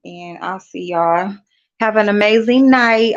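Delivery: ordinary talk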